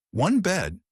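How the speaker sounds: high, loud shocked echo; near shout. A narrator's reading. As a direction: blurt it out in disbelief. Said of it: "nearly shouting".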